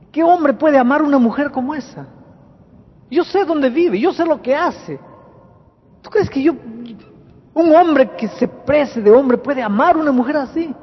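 acted indignant outburst, high and forceful; wounded outcry. Husband denying he could love such a woman, wounded outcry. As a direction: cry out like a wounded beast, pitch way up. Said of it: "gritó como una fiera herida".

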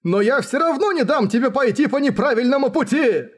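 high, impassioned cry; exclaiming. Acted. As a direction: vow it fervently at a high pitch. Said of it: "воскликнул Матаясу".

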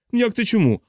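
high, incredulous exclamation; astonished outburst falling from a high start; exclaimed. A narrator's reading. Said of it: "вигукнув Крісті".

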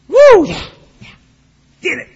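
high, loud celebratory whoop; happy whoop. Pretend. Believer doing a happy dance, whooping with delight. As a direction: cheer like you just won.

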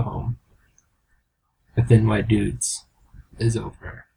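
low, grave movie-trailer narration; understated dramatic announcer; mock film ending. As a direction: close it out like a solemn film.

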